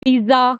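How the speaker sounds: पिज्जा said "pi-za", buzzed z; wrong "pizza" with z. Shown as a demonstration. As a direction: voice a plain z in पिज्जा, no t. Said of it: "इसको बोलते हैं".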